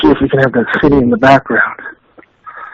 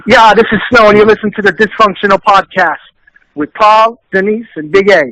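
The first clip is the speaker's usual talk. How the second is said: higher-pitched radio drop read; promo bumper; 4 semitones higher than usual. Acted. Guest recording a bumper for the podcast, promo read. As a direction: deliver it as an artist's station ID. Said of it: "can you do a bumper".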